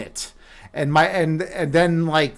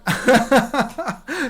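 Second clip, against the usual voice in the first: high laugh; 7 semitones higher than usual. Real, not acted. Laughing at own impression.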